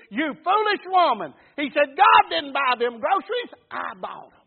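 normal talking voice

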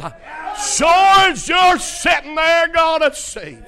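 high, drawn-out preacher's shout; full-force shouted promise in a retold exchange; emphatic shout. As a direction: belt out the assurance, stretching the words. Preacher retelling his emphatic assurance.

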